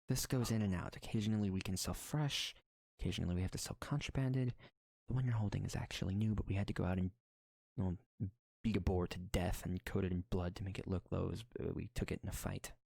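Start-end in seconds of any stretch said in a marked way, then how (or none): none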